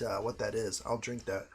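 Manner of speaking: usual speaking voice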